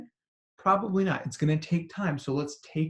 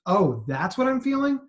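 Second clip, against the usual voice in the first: higher, surprised "oh"; sudden realisation; 6 semitones higher, 3 dB louder than usual. Acted. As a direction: react as if it just dawned on you. Nervous system's acted sudden realisation.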